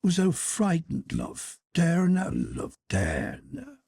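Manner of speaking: put-on soft, slow murmur to a baby; hushed, drawn-out mother's comforting; soothing murmur. A narrator's reading. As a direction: croon gently to calm a crying child. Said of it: "and murmuring".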